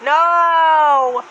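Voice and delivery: long, high, loud cry; screaming. Retold or imitated. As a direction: scream it out on one stretched vowel. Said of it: "while Danny screams".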